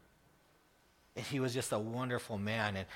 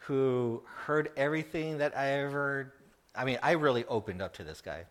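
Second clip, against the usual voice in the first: ordinary talk, nothing marked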